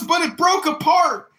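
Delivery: high-pitched loud acted protest; distressed recruit shout. Recruit explains failed cleanup to sergeant, shouting high. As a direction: yell it as a hapless recruit.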